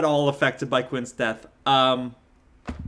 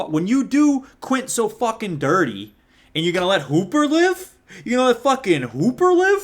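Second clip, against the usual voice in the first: outraged, climbing higher and louder; indignant rant; 6 semitones higher, 4 dB louder than usual. Real, not acted. Fan protesting who survives the film, indignant rant. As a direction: work yourself up in disbelief, voice rising. Ranting indignantly about the film's ending.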